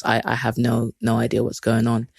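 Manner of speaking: normal talking voice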